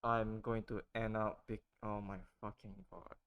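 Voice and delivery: ordinary talk, nothing marked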